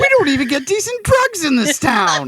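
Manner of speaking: Curtis Armstrong impression. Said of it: "and he goes".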